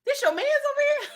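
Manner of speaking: very high, fast, laughing disbelief; laughing high incredulous gangster voice; mock incredulous Papi. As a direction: act amazed at who he brought. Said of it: "Papi was like".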